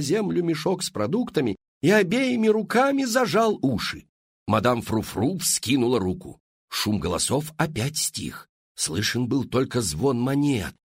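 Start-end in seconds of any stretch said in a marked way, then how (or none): none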